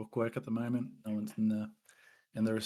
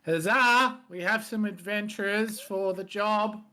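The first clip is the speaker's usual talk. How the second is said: loud, high call up the stairs to the boss; calling upstairs; 10 semitones higher, 6 dB louder, slower than usual. Acted. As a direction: shout it up the stairwell so he hears. Clerk calls up the stairs.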